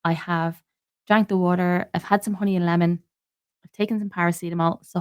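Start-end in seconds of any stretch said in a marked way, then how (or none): none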